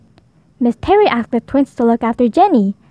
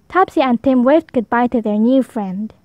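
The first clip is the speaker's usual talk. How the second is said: plain reading voice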